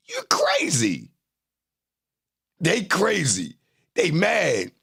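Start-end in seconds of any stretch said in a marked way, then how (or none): 0.1-1.1 mock high voice. Mocking the chat.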